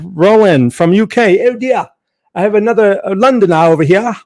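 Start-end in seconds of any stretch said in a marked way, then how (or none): 1.5-4.1 high, loud mock British accent; hammed-up British accent greeting a Londoner. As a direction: play up a London accent for the UK viewer. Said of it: "It's my British accent".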